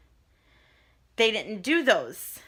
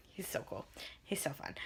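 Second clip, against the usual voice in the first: whispering; 7 semitones lower, 17 dB quieter than usual. Real, not acted. Gushing about her doctor.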